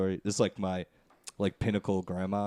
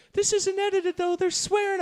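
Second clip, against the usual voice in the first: much higher put-on grandma scolding; 4 dB louder than usual. Grandma catching grandson watching an R movie, high scold. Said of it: "distracted by the tone of voice".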